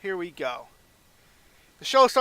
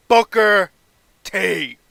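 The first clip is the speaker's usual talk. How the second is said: much louder, ringing out the name Booker T; big announcement; 3 semitones higher, 11 dB louder, much slower than usual. Pretend. GM revealing SmackDown's first pick, loud. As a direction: call the pick like a ring announcer.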